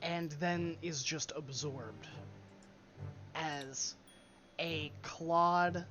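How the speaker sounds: slow, hushed scene setting; game master narration. Acted. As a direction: paint the dragon's arrival slowly. GM describes dragon emerging.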